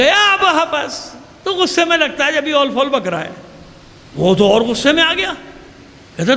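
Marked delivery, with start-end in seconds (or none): 0.0-1.1 slow, high, drawn-out sneering "یا ابو حفص"; contemptuous mocking address. Shown as a demonstration. Husayn mocking the governor with "یا ابو حفص", slow and sneering. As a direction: spit out "یا ابو حفص" slowly, as an insult. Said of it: "کسی کی کنیت اس کے لیے گالی ہوتی تھی".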